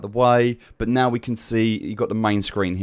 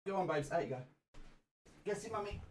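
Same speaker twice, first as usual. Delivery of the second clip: much higher, softer coaxing puppy voice; 9 semitones higher, 19 dB quieter, faster than usual. Real, not acted. Sending dog downstairs.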